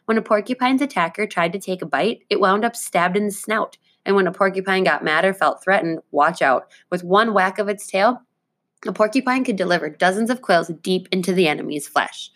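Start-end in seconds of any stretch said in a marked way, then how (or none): none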